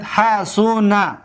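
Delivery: حسن very slow, syllable by syllable; حسن spaced out as ha-suu-na to show the vowel; stretched middle vowel. Shown as a demonstration. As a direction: draw out the "su" in حسن.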